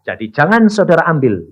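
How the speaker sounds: ordinary talk, nothing marked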